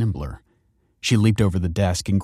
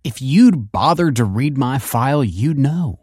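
put-on higher, pointed teenage backchat; insolent retort; 5 semitones higher, 4 dB louder than usual. A narrator's reading. Pupil rebuking principal who skipped her file, insolent. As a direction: needle them with a lilting jab.

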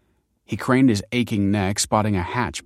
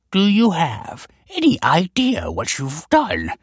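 high, slow, swooping outrage; fuming scholar's drawn-out voice; 9 semitones higher, 4 dB louder than usual. A narrator's reading. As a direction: seethe each phrase at the culprit. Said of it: "fumed the scholar".

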